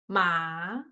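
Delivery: "ma" low, then sliding up; second tone. Shown as a demonstration. Students listen for the difference, "ma" rising. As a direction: let "ma" climb slowly from low. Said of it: "they are different".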